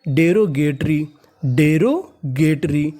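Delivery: Derogatory very slow, split in the middle; slow teaching pronunciation of derogatory; broken into parts. Shown as a demonstration. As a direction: sound out derogatory in pieces. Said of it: "तो meaning बनी".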